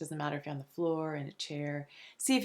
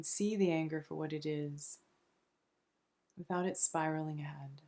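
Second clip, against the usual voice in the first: soft, soothing meditation voice with long pauses between phrases; unhurried, hushed relaxation guide; guided meditation; 2 semitones lower, 4 dB quieter than usual. Acted. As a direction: gently guide them into calm, phrase by phrase.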